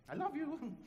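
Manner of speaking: "I love you." ending on a rising, questioning tune; love asked as a question. Shown as a demonstration. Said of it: "you basically phrase it as a question".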